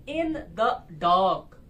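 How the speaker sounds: in the dark slowly, word by word, weight on dark; light "in the", then a strong drawn-out "dark"; stress on DARK. Shown as a demonstration. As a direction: punch the last word of in the dark. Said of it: "last वाला strong हो रहा है".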